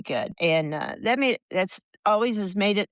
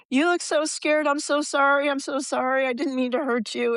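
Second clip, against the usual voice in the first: much higher, gushing apology; apologetic big guy; 8 semitones higher than usual. Retold or imitated. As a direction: imitate the football player saying sorry, pitched way up. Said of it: "came up and said".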